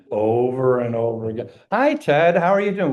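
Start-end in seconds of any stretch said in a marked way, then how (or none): 1.7-2.9 higher, louder bright hello; mock cheery greeting. Grudge-holder greeted by a friend, mock cheery. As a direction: play a friend calling out hello. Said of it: "somebody who just rehearses their grievances".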